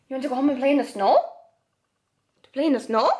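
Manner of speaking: calling Tyler to come home and play in the snow, excited pet voice; talking to the dog. Acted. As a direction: coax him like an eager puppy.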